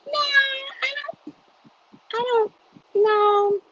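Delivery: very high, slow laughing no; giggly high denial. Real, not acted.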